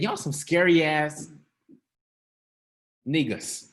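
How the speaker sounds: slow, blunt, unimpressed; blunt street talk. Shown as a demonstration. Preacher voicing Jesus scolding scared disciples bluntly. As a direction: tell them straight like a homeboy. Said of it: "That's what he said".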